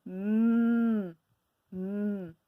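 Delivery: "m" hummed alone, twice; isolated sound. Shown as a demonstration. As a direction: hum the "m" on its own. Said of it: "Ils commencent tous par".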